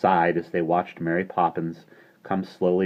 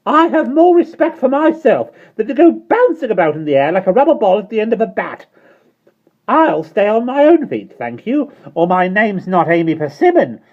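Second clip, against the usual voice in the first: high, loud prim retort, faster at the end; indignant retort; 10 dB louder than usual. A narrator's reading. Landlady retorting to Michael, indignant. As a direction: put the cheeky boy in his place. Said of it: "she retorted".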